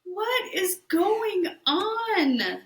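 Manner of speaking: high, drawn-out disbelief; incredulous reaction. Real, not acted. Listener reacting to a retold meltdown, high incredulous drawl. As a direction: stretch it out high, aghast at the story.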